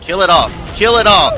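loud, rising battle cry; battle shout. Real, not acted. Gamer cheering himself on in combat.

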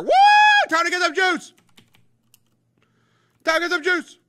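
high, loud whoop of excitement; loud, high hype yell; excited cheer. Real, not acted. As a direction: shout it like a rallying cry. Cheering about making juice.

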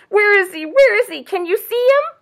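high, loud anxious questions; frightened doe. A narrator's reading. Doe searching for stranger in story, frightened and high. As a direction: play a panicky doe demanding answers. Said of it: "The frightened doe stomped her hoof".

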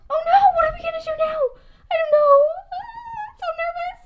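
high, rushed, tearful panic; frantic panic. Acted. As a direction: spill it out fast and frightened. Panic after dropping the camera.

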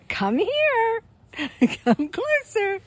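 high, loud call to a pet; high coaxing call. Real, not acted. Calling reluctant dog over to play.

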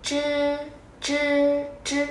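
"chư" phát âm lố, tròn miệng cong lưỡi; "chư" đọc phóng đại để luyện khẩu hình; cường điệu zh. Shown as a demonstration. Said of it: "nó không bị lố như thế này". ("chư" lố hơn khi giao tiếp)

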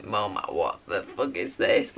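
low, mock outraged voice; shocked at mum. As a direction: pretend horror at a bad outfit. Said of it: "I'm like".

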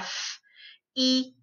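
f, then "eat" in separate sounds; sounded out. Shown as a demonstration. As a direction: break f, eat apart with pauses. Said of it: "We're gonna sound them out".

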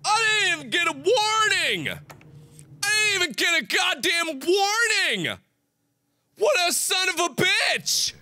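high furious yelling; outraged shouting. Real, not acted. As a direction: yell in real outrage. Outraged at no warning.

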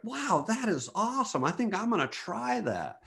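a lightly voiced excited stranger; impressed enthusiasm. Stranger deciding to try swipes, mild mock excitement. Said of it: "He was just like".

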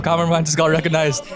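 usual speaking voice

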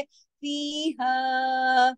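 very slow फीहा, both vowels held; madd. Shown as a demonstration. Teacher models the madd, फीहा very drawn out. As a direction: stretch फीहा out at length. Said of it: "ऊपर मद भी है".

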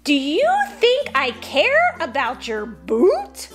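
high-pitched indignant farmer; exasperated, high-voiced tirade; bellowing rant. A narrator's reading. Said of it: "bellowed Peter Peter".

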